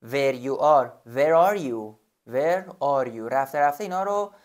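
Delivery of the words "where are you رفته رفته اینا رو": ordinary talk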